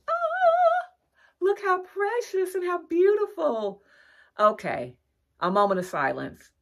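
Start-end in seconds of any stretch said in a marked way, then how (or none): 0.1-3.8 very high, gushing coo; high squeal of delight. Real, not acted. Vlogger cooing at a precious gingerbread house, high squeal. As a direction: squeal over how precious it is.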